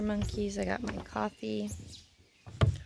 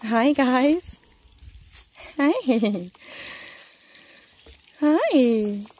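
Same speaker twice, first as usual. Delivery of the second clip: calling out; 2 semitones higher, 9 dB louder, much slower than usual. Real, not acted. Greeting animals loudly.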